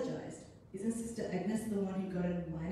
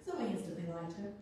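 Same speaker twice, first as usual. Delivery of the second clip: usual speaking voice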